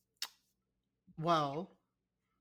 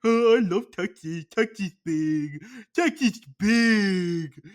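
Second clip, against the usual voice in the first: much louder, higher, slowed-down dopey boast; mock Texas fan; 4 semitones higher, 11 dB louder, slower than usual. Teasing cohost as a loud, slow Texas braggart. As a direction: bellow it like a dim, proud Texan. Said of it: "You're gonna be like".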